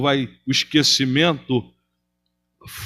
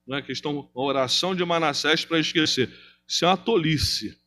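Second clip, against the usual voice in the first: usual speaking voice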